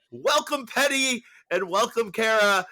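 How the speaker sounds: big, high, loud host welcome; excited welcome. Real, not acted. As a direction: announce it like a proud host. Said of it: "I get to say this since I'm hosting".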